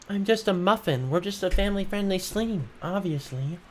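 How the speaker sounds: hammed-up high little-muffin voice; mock innocent. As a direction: play sweet and innocent up high. Innocent put-on voice.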